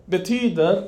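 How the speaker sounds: ordinary talk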